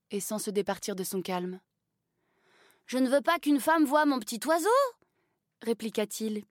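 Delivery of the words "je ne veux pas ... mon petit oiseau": enfant rougissant, aigu et fort; proteste, embarrassé et buté; garçon gêné. A narrator's reading. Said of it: "piquant un fard". (garçon gêné qui refuse, voix aiguë)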